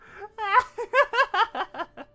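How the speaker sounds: high anime giggle; squeaky giggle. Pretend. Giggles in put-on voice.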